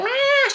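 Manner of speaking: high, sharp mock baby scream; imitated baby scream. Describing her daughter's crying, a high mock scream. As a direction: mimic a toddler's scream in one burst. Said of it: "it's not like a scream".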